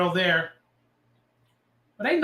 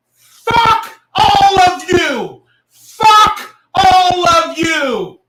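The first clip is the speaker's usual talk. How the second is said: much higher, louder, slow drawn-out yell; slow roaring outburst, much higher and louder; furious shout; 10 semitones higher, 11 dB louder, much slower than usual. Real, not acted. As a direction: blow up and scream it at everyone.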